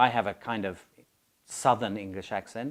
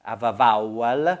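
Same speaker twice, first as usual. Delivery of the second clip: bouncy put-on Italian accent; an Italian learner labouring over English sounds; 4 dB louder than usual. Italian student worried about vowels, Italian accent. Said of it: "my teacher in Italy".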